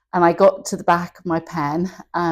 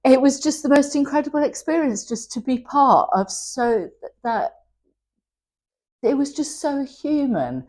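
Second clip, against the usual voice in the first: emotional; 7 semitones higher than usual. Real, not acted. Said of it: "Oh, it made me emotional".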